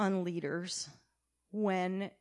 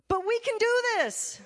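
bold declaration; 12 semitones higher, 6 dB louder than usual. Retold or imitated. Joshua's bold faithful reply.

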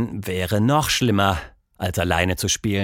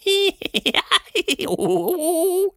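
very high, silly giggling creature laugh; a tiny hidden thing giggling; silly giggle. Acted. Hidden voice in the tree, very high silly giggle. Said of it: "albern giggelnden Klang".

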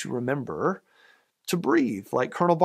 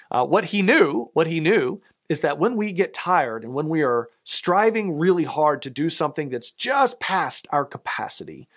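usual speaking voice

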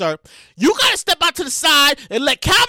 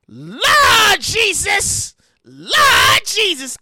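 slow dismayed cry; 3 semitones higher, much slower than usual. Real, not acted. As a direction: groan it to the heavens in disbelief. Mock outrage at the news.

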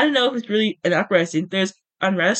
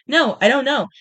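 raised, higher exclamation; heated retort; 6 semitones higher, 5 dB louder than usual. A narrator's reading. Gale arguing back as tempers rise, in a heated voice. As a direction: burst back into the argument. Heated argument read from novel.

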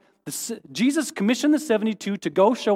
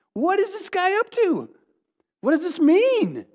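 much higher, baffled wondering; puzzled disciple; 10 semitones higher than usual. Retold or imitated. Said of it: "at some point you gotta go".

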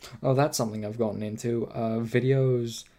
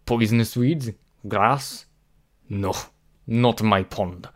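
a touch of a hammed-up Russian accent; loud, badly faked Russian accent; 5 dB louder than usual. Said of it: "do a really bad Russian accent".

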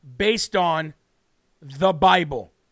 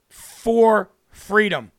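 "for freedom" said slowly and louder, each word weighted; slow emphatic close; 5 dB louder, much slower than usual. Real, not acted. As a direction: lean hard and slow into "for freedom". Host's emotional tribute to fallen soldiers.

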